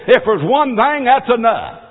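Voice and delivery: loud, high, harsh rising to a shout; harsh preaching. Real, not acted. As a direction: lash out at false teachers. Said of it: "I didn't mean to be so harsh".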